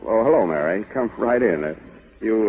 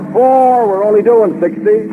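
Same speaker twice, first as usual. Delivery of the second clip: loud, high shout back; cocky driver yelling over the engine; defiant shout; 9 semitones higher, 5 dB louder than usual. Acted. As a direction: brush her off at full volume.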